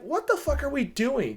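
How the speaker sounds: high-pitched, baffled protest; acted-out disbelief. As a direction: question it in high, baffled exasperation. Re-enacts disbelief at the draft pick.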